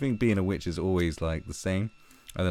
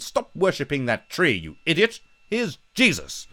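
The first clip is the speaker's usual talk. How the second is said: raised, bossy hammed-up preacher; mock missionary; 8 semitones higher than usual. Colonists telling Africans to stop tree worship, mock bossy. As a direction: hand over Jesus like a smug colonist. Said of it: "They went".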